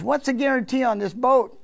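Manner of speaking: mock calling out as he drives off; retold yell. Customer calls back from the driveway, a mild yell. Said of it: "He yelled out".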